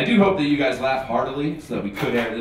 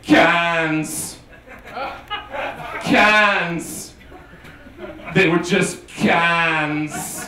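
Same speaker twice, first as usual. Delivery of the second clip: slow, dazed Keanu Reeves, louder, laughing through it; Keanu Reeves impression; 6 semitones higher, 3 dB louder, much slower than usual. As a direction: draw out each cans like a stunned Keanu. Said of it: "This is my impression of Keanu Reeves".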